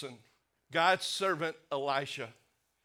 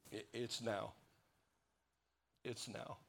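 it's now, dropped very soft and low; hushed; 6 semitones lower, 14 dB quieter than usual. Real, not acted. Pastor to congregation about Ukraine, hushed and grave. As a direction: let it fall to near silence.

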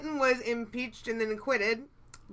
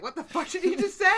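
higher pitch, laugh creeping in; baffled mock disbelief; 6 semitones higher than usual. Host imitating a viewer caught by a silly line, laughing disbelief. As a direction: do an amused double take. Retold baffled reaction to show.

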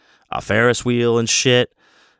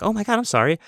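high apologetic desk lady; 12 semitones higher, faster than usual. Retold or imitated. Voicing the nice desk lady.

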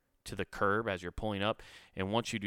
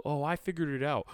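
smug mock; 7 semitones higher than usual. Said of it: "they're very twatty about it".

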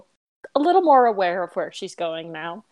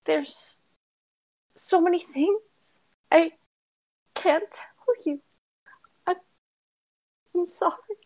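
high, trembling apology; tearful girl voice; 10 semitones higher than usual. Acted. Shaky girl apologizing to her friend, high and tearful. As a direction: hold back tears as she apologizes.

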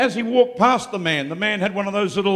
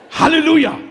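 high, loud hallelujah; preacher rousing the congregation; exultant shout; 9 semitones higher, 8 dB louder than usual. Real, not acted. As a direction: shout it in triumph.

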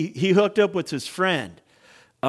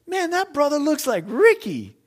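high-pitched, disbelieving double take; comic high-voiced astonishment; imitated puzzled brother; 12 semitones higher, 3 dB louder than usual. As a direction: act out a brother spotting a familiar face. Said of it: "He goes".

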